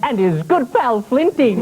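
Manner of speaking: slow, drawn out mock chummy tone. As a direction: purr it like an old mate. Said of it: "with the professor chiming in".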